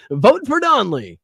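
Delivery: high, drawn-out, loud jeer; mock slogan. Host ridiculing the campaign pitch, mocking high voice. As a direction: stretch it out in a silly high voice.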